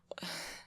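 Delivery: short, soft sigh; frustrated sigh. Acted. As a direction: sigh while searching for words. Frustrated at explaining themselves.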